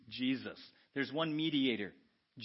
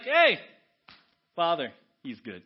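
Jesus vouching; 9 dB louder than usual. Acted. Jesus presenting a believer to the Father, upbeat. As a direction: vouch for a friend at the door. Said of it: "it's like".

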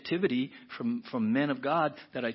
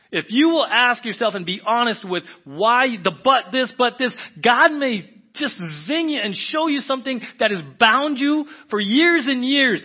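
high, loud, driving exhortation; full-force preaching; 10 semitones higher, 13 dB louder than usual. Real, not acted. Preacher telling listeners God will free them, full-force preaching. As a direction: preach it urgently at full volume.

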